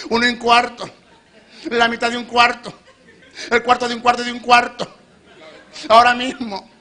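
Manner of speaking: loud, high, playful altar-call countdown with laughs; teasing countdown. Acted. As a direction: joke through it, egging the crowd on. Cuenta regresiva burlona al llamado. (cuenta regresiva juguetona y fuerte, entre risas)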